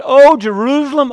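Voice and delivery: loud, high lament on "Oh, Jerusalem"; impassioned loud lament; anguished cry. Acted. Jesus lamenting over the city.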